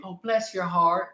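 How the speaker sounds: put-on an older Southern woman, sweetly condescending. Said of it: "an older woman says to you".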